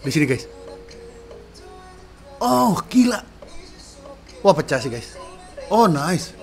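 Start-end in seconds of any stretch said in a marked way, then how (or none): none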